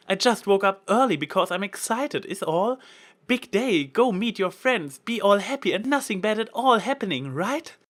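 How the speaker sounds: high, loud, overdone cheer; bright fake enthusiasm, pushed way up; forced excitement. A narrator's reading. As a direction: lay the excitement on thick. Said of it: "laying it on a bit thick".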